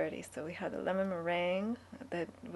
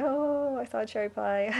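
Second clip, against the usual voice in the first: mock exclamation; 4 semitones higher, 5 dB louder than usual. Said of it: "I can't say".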